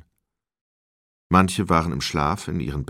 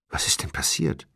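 hushed, nearly voiceless anxious question; trembling man. A narrator's reading. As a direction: ask it under your breath, unnerved. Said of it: "der Mann mit zitternder Stimme".

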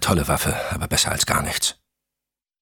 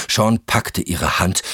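ordinary delivery, nothing marked

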